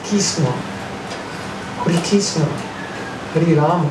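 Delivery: imitating a Bengali accent; Bengali-accented mantra, r dropped from "Krishna". Shown as a demonstration. Teacher showing how Bengalis say the mantra, Bengali accent. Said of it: "In Bengali, it's Krishna".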